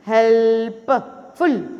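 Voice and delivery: "Helpful" very slowly, part by part; chopped-up teaching "helpful"; split into syllables. Shown as a demonstration. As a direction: stretch help, pause, then फुल in "helpful". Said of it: "कितने हिस्से में अलग-अलग करते हैं".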